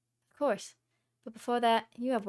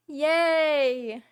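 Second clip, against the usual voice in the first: excited gasp; 6 semitones higher, 7 dB louder than usual. Acted. Joy at grandpop in audience.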